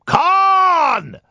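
high, drawn-out roar of a name; hammed-up long scream; imitated film yell. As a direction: bellow it like an anguished movie captain.

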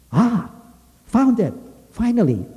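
very high, loud excited exclamations; triumphant discovery. A narrator's reading. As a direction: burst out with relief at finding it. Bluebird finally finds the singer.

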